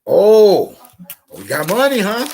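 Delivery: high, loud mock surprise at the cash; a hammed-up pal spotting money; mocking buddy. To the speaker, a buddy eyeing his cash, mock surprise. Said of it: "And he said".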